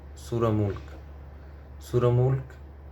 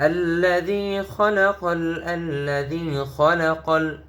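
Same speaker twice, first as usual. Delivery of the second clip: higher, slow chanted phrase; melodic recitation; 6 semitones higher, slower than usual. Shown as a demonstration. Teacher modelling the phrase in tilawah. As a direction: recite like a qari. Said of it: "teacher models phrase".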